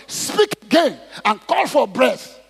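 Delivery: preacher voicing a divine order; God's command. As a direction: order it forcefully, drawing out the words. Voices God commanding Ezekiel.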